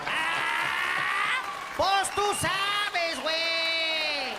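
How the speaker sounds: high, very slow, stretched like a soccer call; drawn-out Mexican Spanish. Retold or imitated. Comedian imitates Mexicans, dragging the words out like an announcer. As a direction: drag it out like announcing a goal. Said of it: "That voice sound like a soccer announcer".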